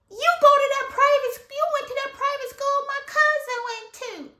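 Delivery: high, loud, fast gotcha; mock triumphant shout; loud triumphant reveal. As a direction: announce it to the room. Classmate loudly reveals.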